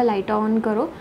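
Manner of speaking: ordinary talk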